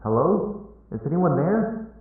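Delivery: high, questioning call for anyone around. A narrator's reading. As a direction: call into an apparently empty store. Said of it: "heard a male voice call out".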